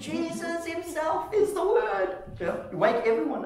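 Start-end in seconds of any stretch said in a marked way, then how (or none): 0.0-2.2 high, drawn-out squeal of revelation; mock excited screaming. Preacher waking everyone with a revelation, mock excited squeal. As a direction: reenact an elated outburst, pitched way up without volume. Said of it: "screaming and shouting".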